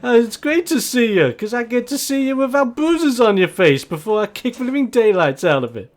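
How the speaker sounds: high, loud, laughing taunt; taunting champ. Acted. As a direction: laugh while you threaten him.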